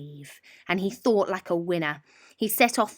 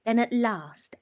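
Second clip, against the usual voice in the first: ordinary delivery, nothing marked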